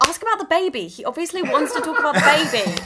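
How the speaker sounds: high, loud, exasperated plea with a laugh creeping in; mock-frustrated heckle pitched well up; exasperated urging. Listener recalling what they wanted to yell at the scene, exasperated. Voicing own urgent reaction while listening.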